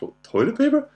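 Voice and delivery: high, loud questioning echo, imitated; rising mock-baffled repeat; incredulous daughter. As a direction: mimic a daughter's amused disbelief. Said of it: "And she's like, she laughed".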